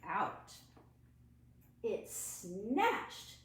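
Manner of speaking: put-on lively picture-book read-aloud to children; story reading. Librarian reading The Wind Blew to children, lively. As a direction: paint each gust for the little ones.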